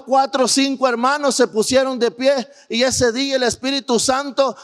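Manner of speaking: usual speaking voice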